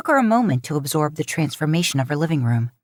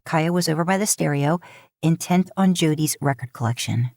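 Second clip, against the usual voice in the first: plain reading voice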